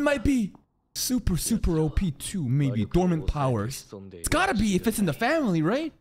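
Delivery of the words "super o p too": ordinary talk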